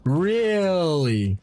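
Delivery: "Really" starting high and sliding down low; high-to-low pitch. Shown as a demonstration. Streamer testing a viewer's remark, "Really" falling high to low. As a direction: stretch "Really" on a long falling glide. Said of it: "start your words high pitch and then end them low pitch".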